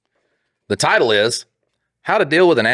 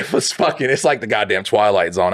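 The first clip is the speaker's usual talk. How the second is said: normal talking voice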